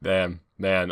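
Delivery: ordinary talk, nothing marked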